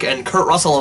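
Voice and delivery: normal talking voice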